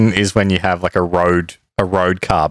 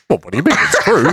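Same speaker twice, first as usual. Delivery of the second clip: laughing; 6 semitones higher than usual. Retold or imitated. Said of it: "he's like".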